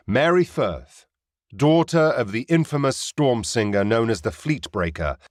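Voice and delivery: ordinary delivery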